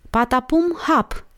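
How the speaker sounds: high-pitched order to a dog; playful command. A narrator's reading. Girl commands dog in tricks. (comandă jucăușă, pe ton înalt)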